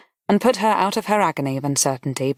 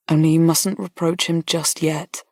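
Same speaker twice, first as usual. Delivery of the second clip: ordinary delivery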